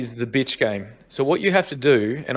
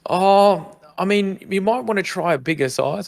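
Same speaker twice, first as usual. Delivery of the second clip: put-on polite, higher shop girl voice; fake-concerned saleswoman; shop assistant voice; 7 semitones higher, slower than usual. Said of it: "she comes out, she's like".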